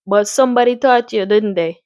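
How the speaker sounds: put-on Caribbean-accented doctor. Doctor chatting about the farm, in a Caribbean accent. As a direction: do your best playful Caribbean accent. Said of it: "I'm gonna give him a Caribbean accent".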